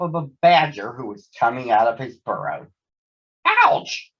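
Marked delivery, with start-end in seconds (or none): 3.5-4.1 sudden high yelp of pain; startled ouch. Acted. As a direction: yelp it out, caught by surprise. Todd falls onto a badger.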